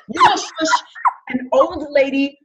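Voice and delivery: high incredulous squeal. Real, not acted. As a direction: squeal it in disbelief. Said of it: "mock-outraged rant about shushing".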